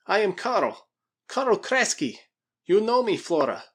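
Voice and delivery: high, loud, urgent appeal; pleading Russian. Acted. Krasky tells Flora who he is, high and pleading.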